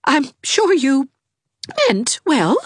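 high, slow, wavering mother; a hurt mother backing down; lightly voiced tremulous lady. A narrator's reading. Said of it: "said Missus Raymond tremulously".

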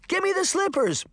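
put-on very high, excited child's plea; eager little girl. A narrator's reading. Daphne pleading for the magic slippers, very high and eager. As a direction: beg like a kid at a toy shop.

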